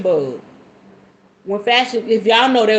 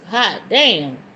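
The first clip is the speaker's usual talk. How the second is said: normal talking voice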